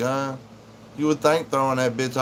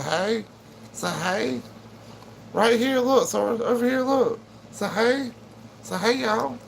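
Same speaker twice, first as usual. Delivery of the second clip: much higher pet voice coaxing the dog; 11 semitones higher than usual. Real, not acted. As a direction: pitch way up to get the dog to say hi. Coaxing dog to greet viewers.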